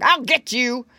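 loud, threatening bad dog voice; barking dog. A narrator's reading. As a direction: bark the threat out loudly. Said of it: "barked the dog".

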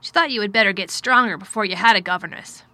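firm but mildly distracted guardian; troubled guardian. A narrator's reading. Guardian considering his ward's wishes, lightly firm. Narrator voices Mister Craven.

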